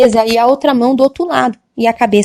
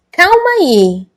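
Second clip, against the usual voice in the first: calling halt; 6 semitones higher, 4 dB louder, much slower than usual. Retold or imitated.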